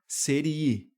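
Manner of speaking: "serie" stressed on the i, se-RI-e; slow "serie" with the stress on "ri"; imperative verb stress. Shown as a demonstration. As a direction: lean on the middle i of "serie". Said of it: "lá no imperativo".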